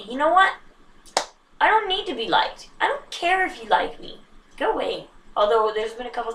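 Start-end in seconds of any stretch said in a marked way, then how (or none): 0.0-5.1 imagined defiant self. Pretend. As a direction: toss it off defiantly, pitched up. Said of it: "like my I N T J is and say".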